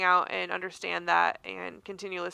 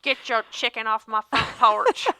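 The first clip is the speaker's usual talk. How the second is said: louder mock Southern neighbor complaining; southern accent; 2 semitones higher, 7 dB louder than usual. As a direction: play a cranky Southern neighbor on the app. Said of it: "they all have southern accents".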